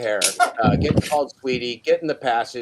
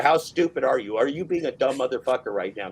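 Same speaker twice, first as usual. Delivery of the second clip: ordinary talk, nothing marked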